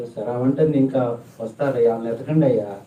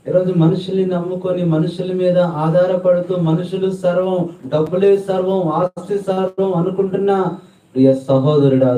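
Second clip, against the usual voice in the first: high, loud preacher's cry to the crowd; fiery preaching; 6 semitones higher, 4 dB louder than usual. Acted. Preacher urging the congregation, in a high, loud cry. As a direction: preach it with rising fervour.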